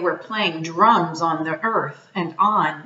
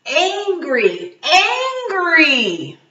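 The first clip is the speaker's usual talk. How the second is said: slow, rising louder and higher each angry; building anger; 8 semitones higher, 4 dB louder, much slower than usual. Acted. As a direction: let the anger swell on each repeat. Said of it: "he wondered".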